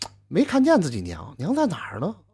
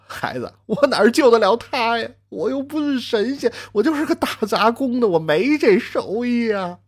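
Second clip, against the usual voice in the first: higher, tearful lament; helpless grieving reply; 7 semitones higher than usual. Acted. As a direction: answer the boy in helpless grief. Grieving father answers son. (父亲哭着无奈回答孩子)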